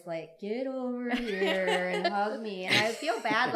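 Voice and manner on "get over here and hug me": drawn-out mock grandmotherly coaxing; grandmother demanding hugs. Grandmother grabbing the boys for a hug, playfully drawn out. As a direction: drag it out slowly, laughing. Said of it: "I have to grab her boys".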